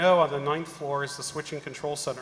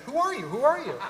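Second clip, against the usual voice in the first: high, alarmed repeated question; confused demand; 10 semitones higher, 4 dB louder than usual. Retold or imitated. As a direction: play the baffled woken-up supervisor, pitched well up. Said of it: "she's saying".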